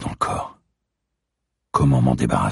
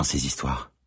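ordinary delivery, nothing marked

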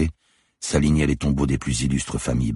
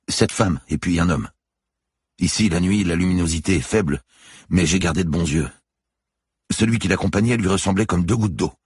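lightly voiced guard, a little higher; explaining guard; 5 semitones higher than usual. A narrator's reading. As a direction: recount it to the officer as a witness. Guard's dialogue voice. (gardien qui explique, voix légèrement haussée)

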